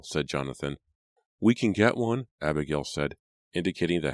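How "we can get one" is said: put-on little girl voice. A narrator's reading. Abigail's voice.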